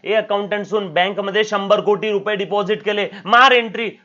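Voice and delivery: loud, high, pushy command in Marathi; bossy order. Retold or imitated. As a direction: shout the order at the accountant. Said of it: "accountant को जाके बोल दिया".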